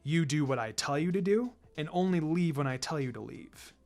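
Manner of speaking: a calm assassin laying down the law to his students; stern sensei Kakashi. Acted. Kakashi addresses his team.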